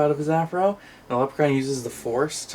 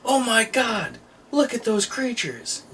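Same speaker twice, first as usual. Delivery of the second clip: higher, slow, hammed-up soldier gasping at aliens; soldier amazed; 10 semitones higher, slower than usual. Soldiers on an alien planet spotting creatures, hammed-up awe. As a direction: play a cheesy B-movie soldier gawking. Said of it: "And they go".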